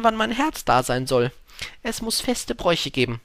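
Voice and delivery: lightly voiced eager storybook fox; fox, eagerly anticipating.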